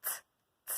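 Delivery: "T" sounded alone, twice; isolated sound. Shown as a demonstration. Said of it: "on va enlever le".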